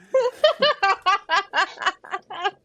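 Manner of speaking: loud, high-pitched guffaws; laughing. Real, not acted. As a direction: burst out in a long, helpless laugh. Cracking up at own joke.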